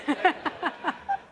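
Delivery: loud, high run of laughter; hearty laugh. Real, not acted. Presenter has just admitted joking to audience, laughing heartily. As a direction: laugh out loud at your own joke.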